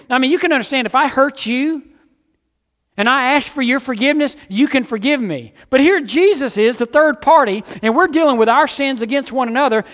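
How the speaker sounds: high, loud, fervent preaching; full-force preaching. Acted. Pastor pressing the congregation on forgiveness, fervent and loud. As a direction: drive it home to the congregation.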